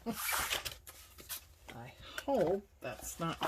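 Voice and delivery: breathy laugh through the words, slow. Real, not acted. Laughs at crooked paper.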